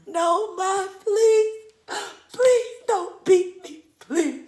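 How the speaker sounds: high, loud hammed-up sobbing plea; tearful begging. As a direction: beg mom through mock sobs. Acted crying, begging not to be beaten.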